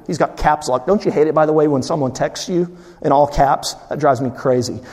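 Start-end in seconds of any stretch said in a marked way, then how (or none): none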